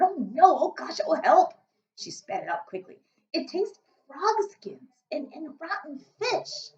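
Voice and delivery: disgusted. Acted. Sophie disgusted.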